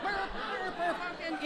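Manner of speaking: high, soft flustered vaudeville babble; vaudeville stammer. Retold or imitated. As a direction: sputter like an old vaudeville act caught out. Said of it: "I'm a vaudeville act".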